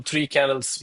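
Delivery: usual speaking voice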